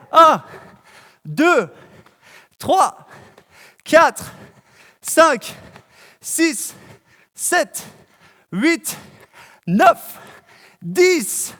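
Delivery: shouting out the count mid-exercise; loud workout counting. Real, not acted. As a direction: bark each number while jumping. Jumping jacks, counting out loud. (compter à voix forte en plein effort)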